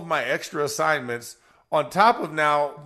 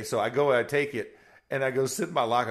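ordinary delivery

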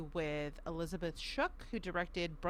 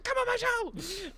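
much higher, loud eager call; excited shout; 6 dB louder, slower than usual. Retold or imitated. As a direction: belt it out like an eager invite. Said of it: "I was like".

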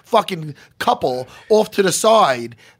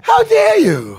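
mock outrage; 9 dB louder, much slower than usual.

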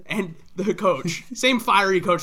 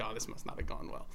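hushed aside; 8 semitones lower, 17 dB quieter, faster than usual. Retold or imitated. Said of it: "So we're like".

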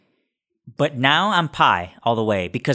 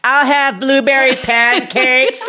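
high, slow, louder customer voice; imitating loud customer; 12 semitones higher, 5 dB louder, slower than usual. Man mimicking the lady at the next table, high and loud. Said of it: "She's like".

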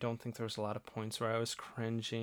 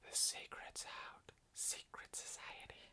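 slow conspiratorial whisper; 14 dB quieter than usual. Pretend. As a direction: whisper it like a secret. Secret society joke.

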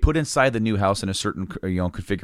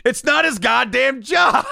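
slow, furious shout, much higher and louder; angry shout; 4 dB louder, slower than usual. Retold or imitated. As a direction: bellow it like a fed-up worker who has had enough. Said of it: "looked at her and said".

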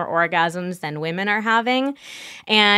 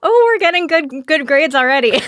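bright, pleased burst, higher and louder; delighted exclamation; 7 semitones higher, 5 dB louder than usual. Real, not acted. Guest delighted that the hosts are catching on, excited. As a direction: cheer that the interview is going well. Delighted at the interview going well.

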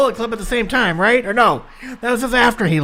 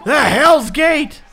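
excited shout; 4 semitones higher, 5 dB louder, slower than usual. Real, not acted. Excited call of a wrestling move.

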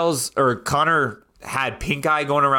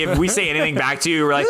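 ordinary talk

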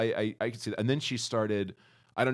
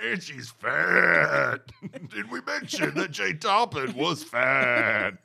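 mocking; 6 semitones higher, 5 dB louder, much slower than usual. Mocking repetition, slow and loud.

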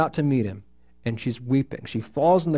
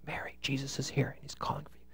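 quick hushed whisper; secret whisper; 15 dB quieter, faster than usual. Acted. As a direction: lean in and whisper the news privately. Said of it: "Bible says secretly".

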